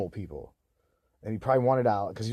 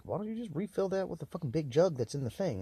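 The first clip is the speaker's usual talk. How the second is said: mocking mom voice, higher and quieter; quoting mom; 7 semitones higher, 4 dB quieter than usual. Mom nagging about water bottles, mocking voice. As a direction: imitate mom's exasperated question. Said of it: "she's like".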